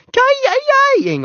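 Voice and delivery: very high, loud ki-yi yelps; dog yelping. A narrator's reading. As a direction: yelp like a fleeing hurt dog. Imitates a fleeing dog's yelps.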